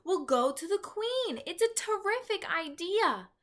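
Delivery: put-on excited girl. A narrator's reading. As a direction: bubble over with the plan. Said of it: "cried Sophie".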